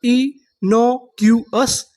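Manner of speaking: very slow, drawn-out reading; innocuous drawn out slowly, syllable by syllable; slow syllable demo. Shown as a demonstration. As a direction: stretch each syllable of innocuous. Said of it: "उसे English में क्या बोलते हैं?".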